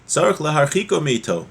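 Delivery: ordinary talk, nothing marked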